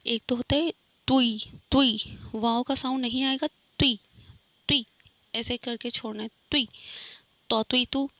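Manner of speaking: توی with no waw sound, short and thin; clean tui sound. Shown as a demonstration. Teacher drilling the exact sound of توی. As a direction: release توی lightly, without a w. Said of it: "اچھا جی اسے کرنا ہے توی".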